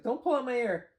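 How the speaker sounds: high squeaky puppet voice. Pretend. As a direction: voice the puppet pleading, high and loud. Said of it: "He's like".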